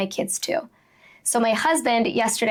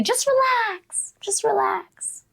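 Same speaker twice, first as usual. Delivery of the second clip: high, drawn-out patronizing coaxing; slow, pitched-up imitation of unwelcome advice; mock advice; 10 semitones higher, slower than usual. Somebody telling you how to feel, slow mock coaxing. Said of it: "when somebody says to you".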